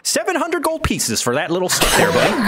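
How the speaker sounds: high, lively haggler's offer; folksy wizard. Acted. Wizard offers gold for little stick, folksy voice. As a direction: pitch it up like a folksy dealer.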